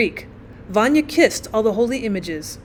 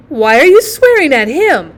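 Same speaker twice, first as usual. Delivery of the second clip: loud, higher-pitched protest; indignant cry; 4 semitones higher, 10 dB louder than usual. A narrator's reading. Aunt rounds on the mother for swearing, indignant cry. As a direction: cry out in the boy's defence. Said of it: "cried the aunt".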